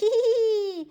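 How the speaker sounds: high squealed giggle; pleased little high-pitched titter. Acted. Joker tickled by own cheese joke, high giggle.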